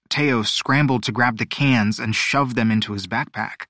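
plain reading voice

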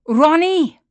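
loud insistent shout. A narrator's reading. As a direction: yell the name. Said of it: "I shout".

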